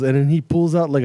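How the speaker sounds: normal talking voice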